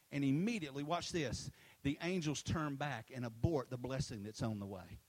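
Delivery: usual speaking voice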